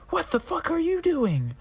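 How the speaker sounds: high, shrill-pitched outraged yell; hammed-up furious high cry; angry shout. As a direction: scream it at him high. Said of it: "I shooted angrily".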